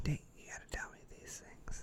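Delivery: breathy whisper. Pretend. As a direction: whisper it to him. Whispered aside.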